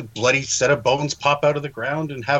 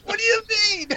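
shocked disbelief. Retold or imitated. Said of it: "and Tommy's like".

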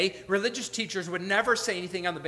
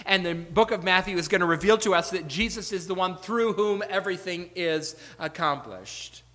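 ordinary talk, nothing marked

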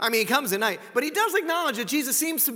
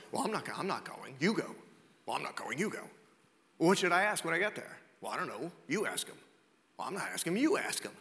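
low, soft mock back-and-forth; reluctant bickering; 5 semitones lower, 5 dB quieter than usual. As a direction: pass the job off under your breath. Acted reluctant debate among Pharisees.

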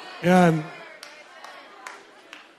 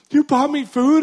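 high, loud amazed question; can't believe someone bought her food; amazed disbelief; 12 semitones higher, 8 dB louder, slower than usual. Retold or imitated. Homeless woman amazed the stranger bought her food, high and loud.

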